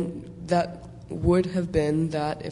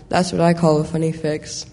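plain reading voice

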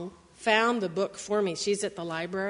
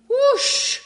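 high loud whoosh; swooping whoosh; 14 semitones higher, 8 dB louder than usual. Acted. Reading to kids, a high rising whoosh. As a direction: sweep up into the air. Lifted by trunk.

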